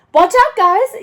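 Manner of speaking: loud warning call; yelling. A narrator's reading. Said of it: "yells Nikki".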